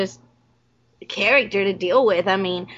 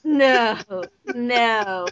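ordinary delivery

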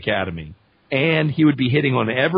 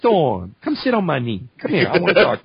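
flirty Cajun coaxing, rising louder; Gambit; 2 semitones higher, 3 dB louder than usual. Acted. Gambit hitting on Storm, playful Cajun coaxing. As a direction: coax her over in a Cajun accent. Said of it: "Gambit would be a totally different character".

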